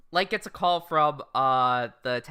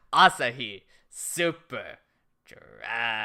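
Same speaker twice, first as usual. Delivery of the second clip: beer ad voice; 5 semitones lower, slower than usual. Retold or imitated. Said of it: "my weeb ass was like".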